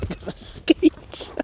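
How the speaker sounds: slow whispered disbelief; awed whisper. Real, not acted. Detectorist confirms the Saxon coin, slow awed whisper. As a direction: breathe it out, hardly believing.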